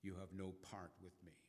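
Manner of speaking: soft and gentle reply; Jesus answering Peter quietly. A narrator's reading. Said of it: "And Jesus quietly says".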